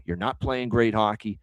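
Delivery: ordinary talk, nothing marked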